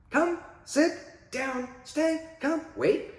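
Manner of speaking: high, upbeat, loud cheery commands; fun and happy. Shown as a demonstration. Trainer cueing a dog in obedience, high happy commands. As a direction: make obedience sound like a game. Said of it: "they're fun and happy".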